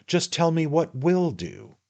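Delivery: high, wheedling man-of-the-world patter; a hint of a knowing little lawyer; sly attorney, coaxing. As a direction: draw him in like a confidant. The attorney talks in the story.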